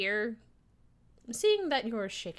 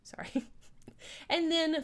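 ordinary talk, nothing marked

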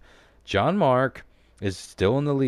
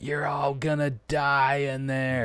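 put-on creepy old man voice; 7 semitones higher than usual. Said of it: "that old creepy dude is like".

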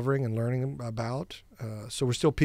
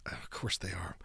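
whisper; 6 semitones lower, 13 dB quieter than usual. Acted. Whispered aside about penguins.

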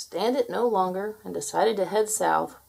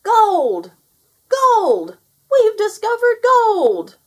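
very high, very loud holler; top-of-voice shout; 14 semitones higher, 11 dB louder, much slower than usual. A narrator's reading. Gnome on mountaintop to all humans, shouting. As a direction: holler it across the mountains at full volume. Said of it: "shouted at the top of his voice".

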